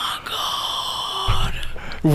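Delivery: breathless gasp of awe. Real, not acted. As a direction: gasp it out in a whisper. Player gasps at cupcake.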